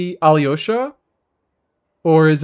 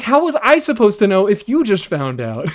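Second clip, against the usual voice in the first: exasperated retort; 6 semitones higher, 4 dB louder than usual. Retold or imitated. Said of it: "I'm like".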